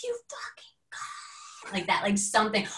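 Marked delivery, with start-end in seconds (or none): 0.0-1.7 high breathy whispered curse. Retold or imitated. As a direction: spit it out under your breath, much higher. Retold cursing at stranger.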